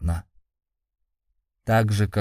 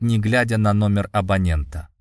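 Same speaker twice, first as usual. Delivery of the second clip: ordinary delivery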